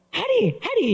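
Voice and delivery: put-on high, sing-song toddler calling her own name. A pastor mimicking his toddler boasting of herself, in a high toddler voice. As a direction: chirp it like a proud little child. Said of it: "이러거든요".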